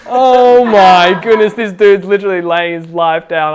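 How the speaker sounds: loud, high, slow gasp of amazement; amazed. Retold or imitated. Telling the group how stunned he was, amazed. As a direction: stretch out the wonder, loud and high.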